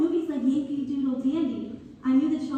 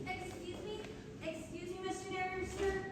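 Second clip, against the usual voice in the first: much softer, higher, shy call for attention; a shy girl softly asking to speak; timid interruption; 5 semitones higher, 14 dB quieter than usual. Acted. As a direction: politely break in on the narrator, small and hesitant. Girl politely interrupts the narrator.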